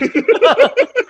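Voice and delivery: high loud laugh; high, loud amused laugh; burst of laughter. Real, not acted. Laughs at trader's bad luck.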